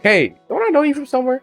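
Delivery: lightly acted high-pitched hail; tentative call, imitated. Boy hails girl on the stairs, imitated high-pitched call. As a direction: lift the pitch as if imitating a hopeful stranger.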